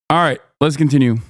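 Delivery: ordinary delivery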